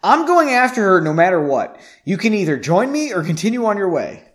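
agitated, high-pitched ultimatum; frantic determination. A narrator's reading. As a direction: insist frantically. Frantic speech continues, defiant.

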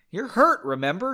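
loud, high start on the retort; a touch of heated reminder; countering. A narrator's reading. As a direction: throw it back at him. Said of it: "she countered".

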